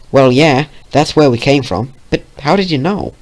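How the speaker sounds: put-on country accent. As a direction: answer like a farm gal. Country pony replying.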